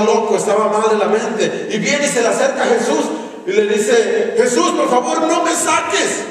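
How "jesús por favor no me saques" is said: higher-pitched, urgent acted plea; agitated pleading. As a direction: beg as a tormented man confronting someone powerful. Acting demoniac pleading with Jesus.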